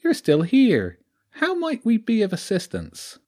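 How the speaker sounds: a sly lounging weasel with a rural Sussex lilt; high-pitched sneaky creature with a country twang. Acted. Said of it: "Its voice was high".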